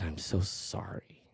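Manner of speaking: soft, slow whisper; hushed sympathy. Retold or imitated. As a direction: barely voice it, stunned and sorry. Moved by florist's sad story.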